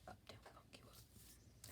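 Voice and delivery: barely audible whisper; almost silent, slow. Real, not acted. Repeated even softer.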